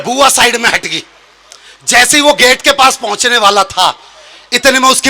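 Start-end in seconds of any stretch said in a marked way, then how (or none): none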